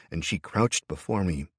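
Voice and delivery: ordinary delivery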